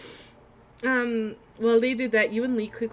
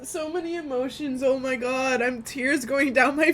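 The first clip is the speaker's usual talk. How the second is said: tearful; 4 semitones higher, 6 dB louder, slower than usual. Real, not acted. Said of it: "I'm actually fucking crying".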